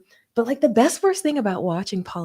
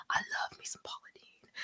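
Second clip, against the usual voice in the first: whispered confession; 15 dB quieter than usual. Real, not acted. Confessing love for Paula Deen.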